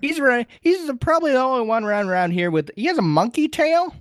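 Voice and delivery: put-on high rambling old-man voice; high-pitched old coot; Master Roshi. Voicing Roshi describing Goku.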